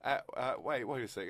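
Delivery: normal talking voice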